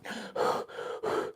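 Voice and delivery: a long unvoiced breath out, soft; imitated excited breathing. Dad imitating his son's nervous breaths on camera, soft exhale. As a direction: mimic the boy's nervous breathing. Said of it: "he started the breathing in the camera".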